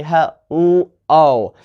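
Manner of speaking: hello broken up with the L dragged out; wrong stress on L. Shown as a demonstration. Teacher showing a wrong hello, stress on the L. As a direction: lean on the L in hello, not the vowels. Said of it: "You cannot stress L".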